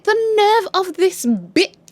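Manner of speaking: high, slow, indignant drawl; high slow indignant outrage; outraged disbelief. Pretend. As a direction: savour the outrage, stretched out high. Outraged at husband.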